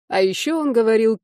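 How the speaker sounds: ordinary delivery, nothing marked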